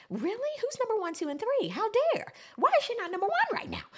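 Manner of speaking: high-pitched, affronted questioning; indignant disbelief. Real, not acted. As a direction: demand answers, pitched well up in outrage. Indignant over the chart ranking.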